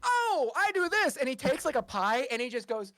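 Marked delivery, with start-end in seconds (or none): 0.0-1.2 high, slow goofy clown voice; silly clown. Acted. Clown Merrywick introducing himself to the bard. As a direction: honk it out like a clown. Clown about to throw pie.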